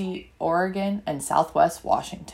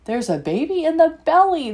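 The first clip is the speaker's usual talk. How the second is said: higher and louder, bursting with news; excited exclamation; 6 semitones higher, 5 dB louder than usual. Acted. A child telling Mommy about the baby, excited and louder.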